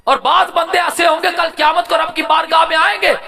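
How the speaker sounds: high, loud, driving sermon delivery; impassioned high-pitched preacher; full-force preaching. Real, not acted.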